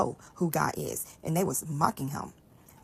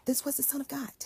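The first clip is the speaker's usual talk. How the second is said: awed, higher whisper; fearful whisper; 7 semitones higher, 4 dB quieter than usual. Acted. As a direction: breathe it in fear and awe. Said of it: "They feared greatly".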